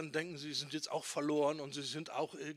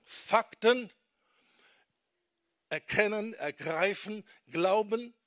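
each word punched high and loud; emphatic list; 5 semitones higher, 8 dB louder, slower than usual. Real, not acted. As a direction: hammer every step home. Teaching the order, emphatic. (jedes Wort laut und hoch betont)

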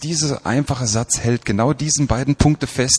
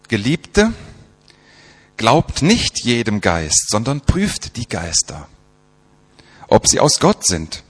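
slow, emphatic Bible reading; scripture reading; slower than usual. Acted. Preacher reading John's letter to the congregation, emphatic recital. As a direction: read the verse out phrase by phrase.

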